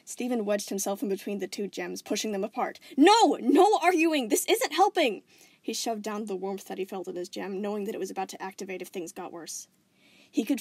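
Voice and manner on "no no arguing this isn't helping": high, loud, slow pleading shout; urgent shout. Acted. Steven pushing the gems apart, urgent shout. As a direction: force them apart with your voice.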